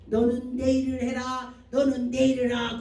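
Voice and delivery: loud, firm divine command. Retold or imitated. Jesus ordering the preacher to do his work, loud commanding voice. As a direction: command it with full authority.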